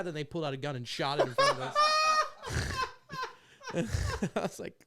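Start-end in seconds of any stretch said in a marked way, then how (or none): 1.8-3.3 high burst of laughter; big laugh. Real, not acted. Laughing at the cat story.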